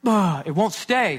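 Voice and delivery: dad mimicking his son's frustration; imitating frustrated kid. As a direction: play the son groaning at his craft. Son frustrated with paper.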